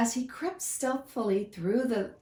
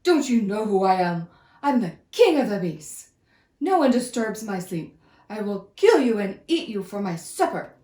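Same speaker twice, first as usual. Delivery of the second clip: loud, booming lion fury; angry lion roar; 7 dB louder than usual. Acted. Woken lion scolding the mouse, loud and furious. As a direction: roar it like a woken king of beasts. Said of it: "the lion roared angrily".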